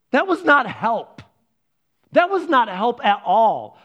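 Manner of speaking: slow, loud, emphatic exasperation; fed-up protest, drawn out for emphasis; exasperated. Retold or imitated. Retelling exasperation at useless help.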